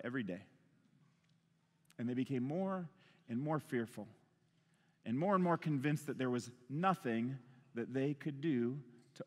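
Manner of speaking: quiet, heartfelt preacher's reflection; solemn hushed preaching. Real, not acted. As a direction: bring the room down to a grave hush.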